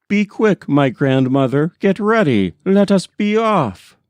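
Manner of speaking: high, pressing urgency; urgent hurry. Acted. Grandson urging his grandmother to flee, high and hurried. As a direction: rush her out the door.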